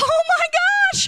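high, breathless oh-my-gosh of amazement; awestruck gasp. Retold or imitated. Preacher retelling finding the word's meaning, awed gasp. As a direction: sound floored by what you just read. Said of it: "I was like".

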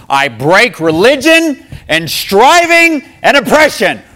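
loud, high, slow divine proclamation; booming voice. Retold or imitated. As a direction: boom out each word like a decree from God. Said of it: "This is a booming voice".

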